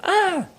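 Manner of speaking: sudden high-pitched yelp; startled yelp. Real, not acted. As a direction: jump with a high cry of surprise.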